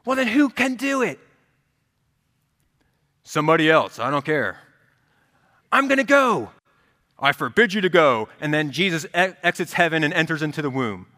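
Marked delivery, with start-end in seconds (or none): 0.0-1.2 put-on exasperated teen son. Acted father-son quarrel. 3.2-4.6 curt dad. Retold or imitated. Acted father-son quarrel. 5.7-6.5 put-on high, loud teenage declaration; defiant teen son. Acted father-son quarrel. 7.2-8.3 stern forbidding dad. Retold or imitated. Acted father-son quarrel.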